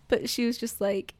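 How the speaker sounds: ordinary delivery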